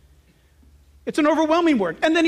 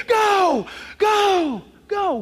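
Spirit's urgent go; 7 semitones higher, much slower than usual. Retold or imitated. The Holy Spirit pushing the believer to go, high and urgent. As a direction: urge them out like a jumpmaster. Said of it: "he's gonna say".

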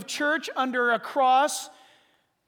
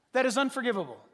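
ordinary talk, nothing marked

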